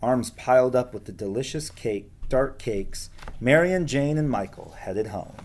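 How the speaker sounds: ordinary delivery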